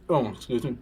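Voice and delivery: ordinary delivery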